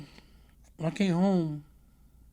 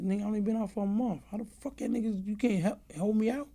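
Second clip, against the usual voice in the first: higher, quick, indignant disbelief; retold protest; 7 semitones higher than usual. Man to his lawyer about a plea deal, retold fast and incredulous. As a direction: fire it off fast like you can't believe it.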